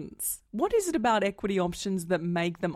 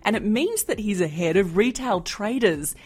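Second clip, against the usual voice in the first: upbeat announcer read over music; podcast intro read; 6 dB louder than usual. Acted. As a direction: present the show like a radio host. Host reads show intro.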